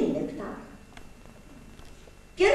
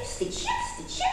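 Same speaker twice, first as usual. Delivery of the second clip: shaming whisper; 6 dB quieter than usual. Acted. Bird shaming others.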